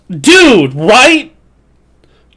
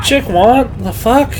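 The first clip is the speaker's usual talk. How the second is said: high, baffled disbelief; confused and incredulous; 7 semitones higher than usual. Retold or imitated. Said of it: "I'm like".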